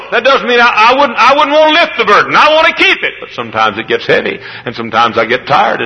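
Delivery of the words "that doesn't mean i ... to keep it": high ringing preacher's cry; full-force preaching. Acted. Preacher to his congregation, full-force preaching. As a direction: lift it up like a revival preacher.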